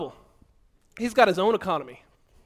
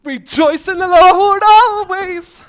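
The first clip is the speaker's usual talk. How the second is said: much higher, louder and slow, a jubilant cry; leaping for joy; 9 semitones higher, 12 dB louder, much slower than usual. Acted. Preacher leaping as he shows real joy, jubilant and loud. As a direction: throw your whole body into the praise. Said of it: "How about them leaps of joy?".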